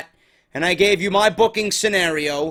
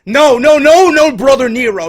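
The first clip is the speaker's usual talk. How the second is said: loud hammed-up yell of Brother Nero; mock Brother Nero accent, yelled loud; 4 semitones higher, 10 dB louder than usual. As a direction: belt out the failed accent impression. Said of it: "I can't do the accent".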